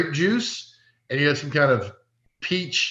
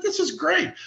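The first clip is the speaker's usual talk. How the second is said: delighted; 13 semitones higher, 4 dB quieter than usual. Retold or imitated. Delighted by the sample workers.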